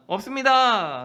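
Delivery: high, loud reply across water; calling back. Acted. Fishermen shouting back to the shore, loud. Disciples answer from boat.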